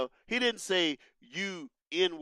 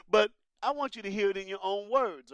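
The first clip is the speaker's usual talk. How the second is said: ordinary talk, nothing marked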